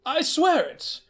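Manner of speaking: a hint of a stunned grandson; stammered thanks as the pretend grandson; young man's voice. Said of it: "Mister Hayworth said".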